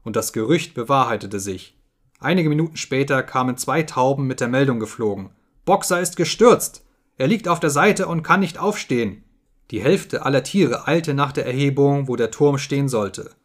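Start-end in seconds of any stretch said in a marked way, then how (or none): 5.6-9.2 urgent raised cry of alarm; alarmed call. A narrator's reading. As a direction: call out the bad news urgently. Pigeons bring alarm news.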